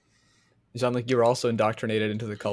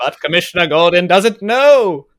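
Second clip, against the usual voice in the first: mock dramatic announcer; 7 semitones higher, 11 dB louder, slower than usual.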